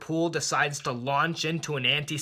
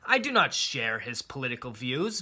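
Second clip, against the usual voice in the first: normal talking voice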